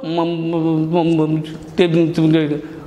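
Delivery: low mumbled syllables, no clear words; mumbling demo. Shown as a demonstration. Teacher demonstrating mumbling to students, low mumble. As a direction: mumble it under your breath to show the fault. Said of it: "What is mumble?".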